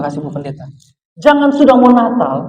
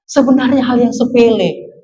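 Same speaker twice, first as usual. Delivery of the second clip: ordinary delivery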